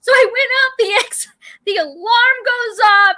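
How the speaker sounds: half laugh, half cry; laughing distress. Real, not acted. Telling a friend the shark story, laughing and near tears. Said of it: "I was traumatized".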